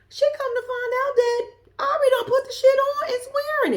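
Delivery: high, loud, outraged disbelief; outraged squeal. Real, not acted. Vlogger retelling that her housemate wore the stock, outraged squeal.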